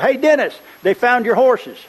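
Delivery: excited high-pitched holler. Retold or imitated. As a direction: holler it out to get his attention. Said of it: "somebody hollered at me".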